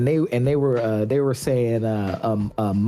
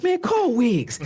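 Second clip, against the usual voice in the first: high-pitched, urgent summons; Andre Iguodala, exasperated; slower than usual. Retold or imitated. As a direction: imitate a veteran calling a teammate over. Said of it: "And he goes".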